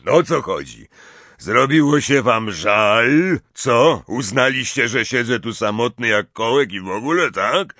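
big sulky character voice; surly giant. A narrator's reading. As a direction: challenge the visitors, hurt and grumpy. Hagrid sulking at his visitors.

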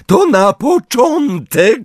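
very high, slow taunting voice; cruel Bellatrix. A narrator's reading. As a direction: relish his pain. Bellatrix after torture. (okrutny, wysoki, powolny głos Bellatrix)

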